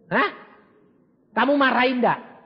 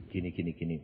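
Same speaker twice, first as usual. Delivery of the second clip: low, softer and even; God's soft answer; 14 semitones lower, 11 dB quieter, faster than usual. Acted. God answering again, low soft calm. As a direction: repeat it calmly as God.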